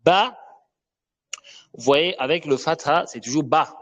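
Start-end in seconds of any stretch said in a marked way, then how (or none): 0.0-0.4 ba said higher and louder; letter ba demo. Shown as a demonstration. Teacher calls out ba to introduce the letter. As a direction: pop the ba out for learners. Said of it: "nous avons le ba".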